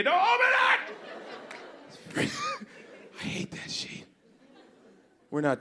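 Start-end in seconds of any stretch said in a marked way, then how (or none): 0.0-2.3 high, alarmed mock yell; high panicked shout. 3.2-4.1 whispered laugh. Real, not acted. Laughing unvoiced aside.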